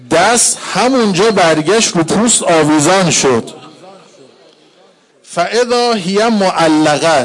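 loud, rising mourning recitation that keeps building; full-force mourning sermon; lamenting preacher. Acted. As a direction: cry out the tragedy to the mourners.

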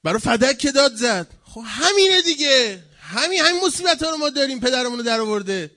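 loud, high-pitched exasperated preaching; heated outburst. Real, not acted. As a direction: raise the voice in heated protest. Heated outburst about Fadak. (فریاد پرشور و معترضانه)

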